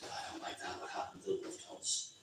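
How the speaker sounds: soft, low-key mutter of imagined officials; hushed disapproval. Acted. Think tank policy guys reacting to the concert, hushed disapproval. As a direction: voice a quiet, uneasy aside as an official.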